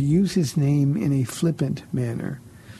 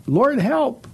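high-pitched urgent plea; crying out; 10 semitones higher, 4 dB louder than usual. Retold or imitated. Host voices sinking Peter to Jesus, crying out. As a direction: cry up high for rescue, as a retelling. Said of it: "he cried out".

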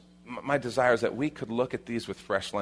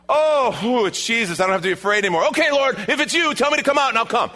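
high, loud, giddy relief; hammed-up eager disciple; mock-relieved Peter; 11 semitones higher, 6 dB louder, faster than usual. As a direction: whoop with relief, then volunteer eagerly. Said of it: "And Peter answered him".

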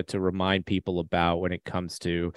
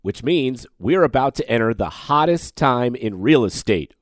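ad read; 3 semitones higher than usual. Acted. Host reads sponsor ad.

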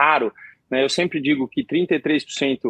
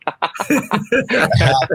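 a real burst of laughter; hearty laugh; 6 semitones higher than usual. Real, not acted. Laughs at his own joke.

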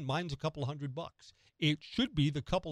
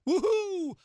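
high, loud woohoo; a quick burst of celebration; a playful whoop of joy; cheer; 5 dB louder than usual. Acted. Celebrating tax refund.

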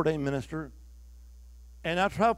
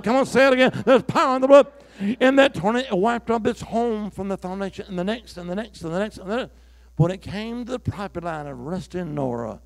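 high, loud revival preaching; preaching at full force; 5 semitones higher, 5 dB louder than usual. Acted. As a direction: lift the whole room as you preach.

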